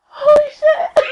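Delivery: very high, loud shriek of disbelief; acted-out starstruck squeal; fangirl squeal. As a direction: shriek it, overjoyed and stunned. Retells reaction to idol's tweet.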